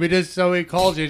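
normal talking voice

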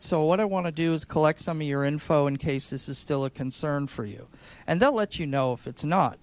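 ordinary talk, nothing marked

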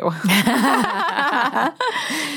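high burst of real laughter; real giggling fit; laughing. Real, not acted. Laughs at her own joke.

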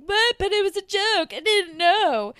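high, loud defensive whine; whiny clueless guy. Retold or imitated. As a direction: play dumb, high and indignant. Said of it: "Derek's like".

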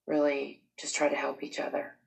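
usual speaking voice

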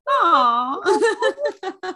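high, laughing aw; touched aw. Retold or imitated. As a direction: coo over something sweet. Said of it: "We're like".

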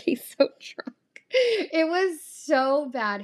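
words dissolving into a laugh, then high, drawn-out. Real, not acted. Telling listeners about the sanitizer mishap, laughing. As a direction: laugh through it, voice lifting high.

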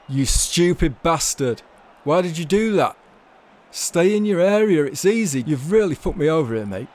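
Tony screams at the sent-off keeper, furious; furious yelling. A narrator's reading. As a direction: bellow abuse down at the tunnel.

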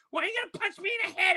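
very high, fast protest; whiny kid. Retold or imitated. Jeffrey protesting the threat in a high fast whine. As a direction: whine it back quickly.

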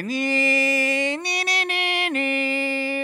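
high sung "ני, ני" ringtone; sung ringtone imitation. Imitating the old people's phone ring, sung high. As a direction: sing the phone's ring high and nasal-free.

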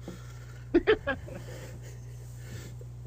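long breathy laugh; wheezy unvoiced laughter. Real, not acted. Laughs at own joke.

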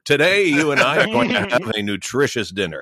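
mock grand narrator at home. Husband coming home, speaking to wife as narrator. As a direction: announce dinner like a story narrator. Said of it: "doing a whole bunch of narration".

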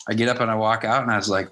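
ordinary delivery

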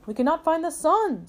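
high, complaining cow; storybook cow. A narrator's reading. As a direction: report the problem as the cow. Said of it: "said Cow".

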